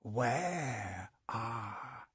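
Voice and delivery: very slow, soft, cold menace; a quiet deadly demand; lilting murderous voice. A narrator's reading. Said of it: "the man's lilting, murderous voice".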